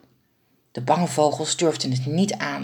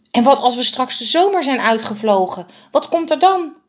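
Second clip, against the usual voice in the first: anxious bird; 7 semitones higher, 3 dB louder than usual. A narrator's reading. Anxious bird asks about future.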